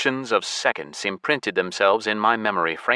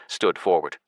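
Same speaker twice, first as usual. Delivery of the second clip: ordinary delivery, nothing marked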